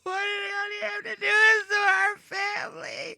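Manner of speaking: very high, shouted mock lament; hammed-up distraught family member; anguished wail. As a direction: cry out to the heavens in despair. Wailing Southern family after [name].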